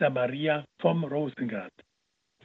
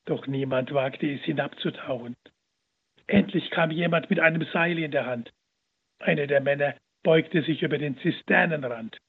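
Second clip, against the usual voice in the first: usual speaking voice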